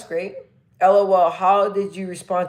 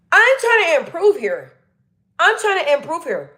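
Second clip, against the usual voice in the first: demonstrating a much higher speaking voice; higher pitch; 9 semitones higher than usual. Shown as a demonstration. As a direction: pitch it well up, falling at the end. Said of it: "I kinda talk at a higher pitch".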